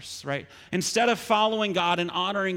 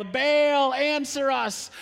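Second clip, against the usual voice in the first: higher-pitched shout to Baal; calling out; 7 semitones higher, 4 dB louder, much slower than usual. Acted. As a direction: prophets calling on their god. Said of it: "they shout out for Baal".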